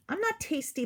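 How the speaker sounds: high begging plea; dog begging the wolf; pleading. A narrator's reading. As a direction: plead for your life. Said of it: "pleaded Dog".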